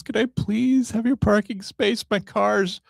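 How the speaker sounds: high-pitched imitation of a tearful woman begging; tearful pleading. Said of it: "she's got tears in her eyes".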